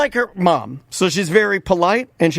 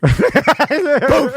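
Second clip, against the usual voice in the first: magic poof; 7 semitones higher than usual. Acted. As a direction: pop it out like a genie's magic. Magic genie sound effect.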